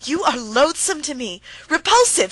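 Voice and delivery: loud, high shriek on repulsive; wife shrieking at her husband. A narrator's reading. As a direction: shriek it at him in disgust. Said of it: "she shrieked".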